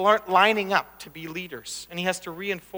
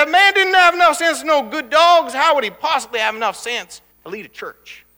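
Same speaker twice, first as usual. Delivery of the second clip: put-on Arkansas accent; 8 semitones higher, 9 dB louder than usual. Preacher quoting an Arkansas author, in an Arkansas accent. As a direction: put on a thick Arkansas backwoods accent, raised and loud. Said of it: "I don't know if I'm doing the accent right".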